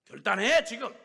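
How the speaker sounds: loud, high barked order; shouted command. Retold or imitated. Elder shouting at her to decide now. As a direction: shout the ultimatum. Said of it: "막 야단을 치셨대요".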